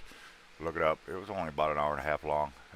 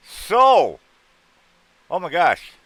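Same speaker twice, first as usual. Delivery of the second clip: high, loud delighted yelp; surprised greeting; 12 semitones higher, 13 dB louder, much slower than usual. Real, not acted. Host spotting his lady friend in chat, loud happy surprise. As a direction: burst out, thrilled to see her. Spotting a long-missed viewer.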